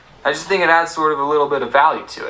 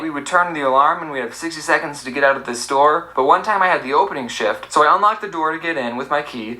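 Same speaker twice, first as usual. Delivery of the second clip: ordinary talk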